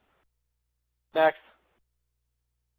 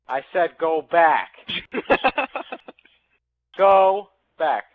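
frustrated command; 7 dB louder, slower than usual. Real, not acted. Frustrated shouting at console.

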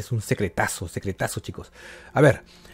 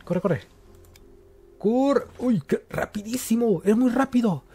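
being chased; 11 semitones higher than usual. Real, not acted. Running from fast monster.

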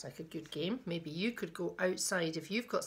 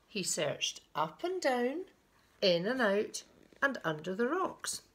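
suspenseful picture-book reading with swooping pitch; lilting storyteller building anticipation; story narration; 6 semitones higher, 4 dB louder, slower than usual. A narrator's reading. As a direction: pause before each discovery. Reads a picture book aloud.

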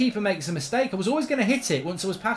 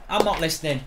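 ordinary talk

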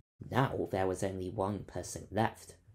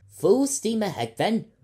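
much higher, louder captain's command; rally the crew; announcing; 11 semitones higher, 12 dB louder than usual. A narrator's reading. Captain rallying his excited crew, loud and high. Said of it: "announced Captain Fryce".

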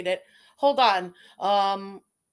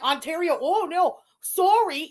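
mock Ontario accent, pitched up; 7 semitones higher, 5 dB louder than usual. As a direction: imitate an Ontario accent in a higher voice. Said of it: "She's got a fucking accent".

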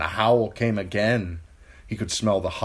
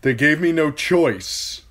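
louder, higher pained plea; anguished protest; 9 semitones higher, 8 dB louder than usual. A narrator's reading. Theon dressing the corpses, mildly anguished protest. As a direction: plead with the dead.

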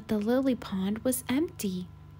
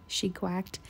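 ordinary delivery, nothing marked

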